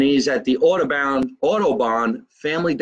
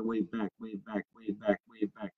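much softer, low murmured repeat; very soft aside; 4 semitones lower, 14 dB quieter than usual. Real, not acted. Waving back at the viewers, very softly under the breath. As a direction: let it drop away almost to nothing.

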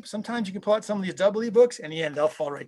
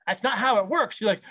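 normal talking voice

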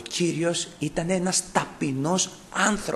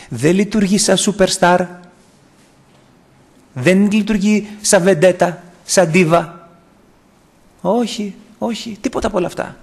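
emphatic preaching; 6 semitones higher, 7 dB louder than usual. Acted. Preacher contrasts Christ with a superstar.

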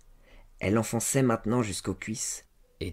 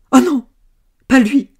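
very high, loud, distressed denial; crying out; 16 dB louder than usual. A narrator's reading. Servant girl denying the lover named, crying out. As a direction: protest in a high wail. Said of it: "elle s'écria".

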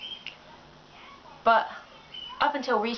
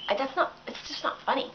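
ordinary talk, nothing marked

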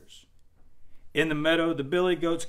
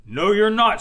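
loud, raised troll shout; 7 semitones higher, 11 dB louder than usual. A narrator's reading. Troll refusing to let goat pass, loud shout. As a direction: bellow it as the mean troll. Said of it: "said the troll".

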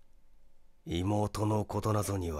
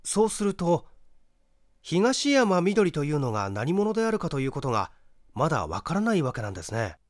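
higher, quick inquisitive male voice; raised, brisk curious young man; young questioner; 7 semitones higher, 4 dB louder, faster than usual. Acted. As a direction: probe eagerly for the answer.

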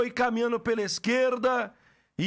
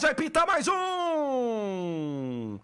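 building goal call; much slower than usual. Real, not acted.

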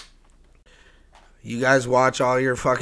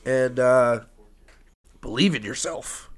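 usual speaking voice

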